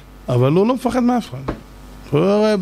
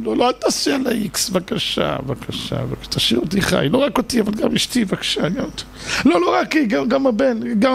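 desperate pleading; 5 semitones higher than usual. Retold or imitated. Pleading with God at Neila.

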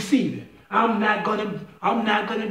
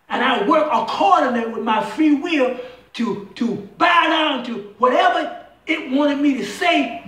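full-force preaching; 3 semitones higher than usual. Acted. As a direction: preach it hard with call-and-response questions.

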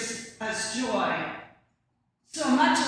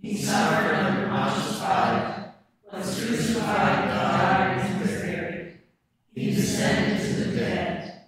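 louder, steady chanted recitation; communal creed recitation; 3 semitones lower, 4 dB louder than usual. Real, not acted. Whole congregation standing to recite the Apostles' Creed, chanted in unison. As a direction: recite the creed together in measured rhythm.

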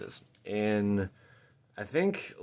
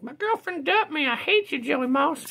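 high, put-on voice of a sulking dumped guy; a high mock rant from a grumpy card character; whiny angry dude. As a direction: play the card's angry dude in a much higher voice. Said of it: "This dude's just angry about everything".

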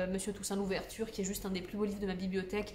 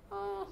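pained ah; 11 semitones higher, 5 dB quieter than usual. Real, not acted. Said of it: "je me suis mordu la langue".

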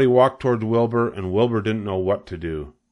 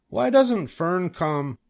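near tears; 9 semitones higher, much slower than usual. A narrator's reading. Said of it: "He began to cry".

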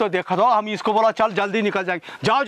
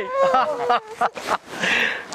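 joyful laugh; 12 semitones higher, 4 dB quieter than usual. Acted. Husband overjoyed at baby's birth.

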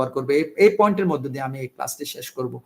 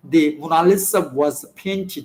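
slow dictation; much slower than usual. Shown as a demonstration. Teacher dictates example sentence.

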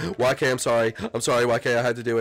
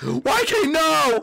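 urgent high-pitched protest; alarmed cry; 11 semitones higher, much slower than usual. Real, not acted. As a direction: cry out high as a teammate falls. Teammate is dying in game.

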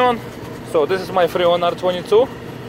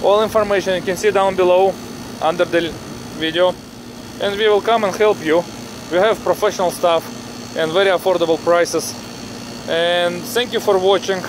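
English with a heavy Russian accent. Real, not acted. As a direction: speak with a strong Russian accent. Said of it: "Sorry about this heavy Russian accent".

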